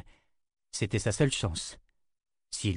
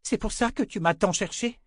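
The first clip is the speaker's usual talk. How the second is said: angry outburst; 11 semitones higher than usual. Acted. Callan continues outburst.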